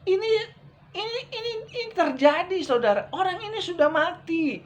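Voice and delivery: excited preaching. Real, not acted. Preacher's exclamation building up. (seruan khotbah yang bersemangat dan tinggi)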